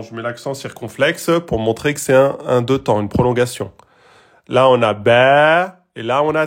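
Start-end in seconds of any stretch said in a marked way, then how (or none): 4.9-5.8 "ba" held over two beats; long vowel. Shown as a demonstration. Teacher showing the prolongation, long "ba". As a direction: stretch "ba" with a lengthened vowel. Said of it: "on est passé en deux temps".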